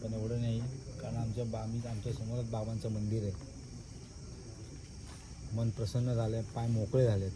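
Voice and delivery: soft, low night talk; quiet at night. Real, not acted. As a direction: speak softly so nobody wakes. Late night, speaking softly near sleepers.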